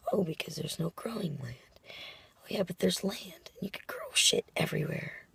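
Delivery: hoarse whisper from a lost voice. Real, not acted. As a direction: whisper through a sore, lost voice. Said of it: "lost my voice at work".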